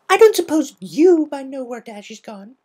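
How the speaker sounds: high, squeaky and loud; bubbly pony asking a foal; Pinkie voice. Acted.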